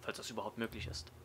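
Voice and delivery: ordinary talk, nothing marked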